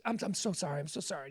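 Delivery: low, soft sarcastic sorry; fake-apologize under your breath; mock apology. Host imitates Baldwin's low sarcastic apology to the neighbor. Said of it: "And that's when Alec Baldwin went".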